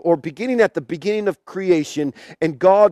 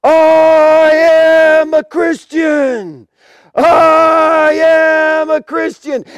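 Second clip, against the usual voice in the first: long drawn-out, high shout; acted martyr crying out while being crushed; 9 semitones higher, 8 dB louder, much slower than usual. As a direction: bellow it to the crowd with the last strength. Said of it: "as he's crying out".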